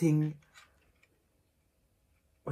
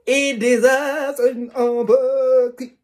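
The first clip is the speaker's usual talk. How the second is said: slow, much higher and louder; thrilled squeal; 10 semitones higher, 15 dB louder, slower than usual. Real, not acted. Vlogger thrilled, announcing an unboxing to viewers. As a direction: belt it out thrilled. Repeated with growing excitement.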